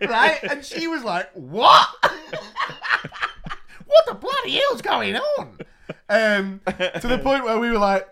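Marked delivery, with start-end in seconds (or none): 1.3-5.6 high, loud shocked outburst. Retold or imitated. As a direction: squeal it out in disbelief, laughing. Teacher's shocked reaction retold.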